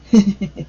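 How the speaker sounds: quick little giggle; real short chuckle. Real, not acted. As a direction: chuckle at your own hedging. Laughs at being almost done.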